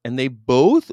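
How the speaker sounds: usual speaking voice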